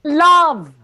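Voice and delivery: loud high joyful proclamation of love; joyful declaiming. Acted. Fess recites his bad poetry, joyful loud declaiming. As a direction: proclaim it to the rafters. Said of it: "recites his bad poetry joyfully".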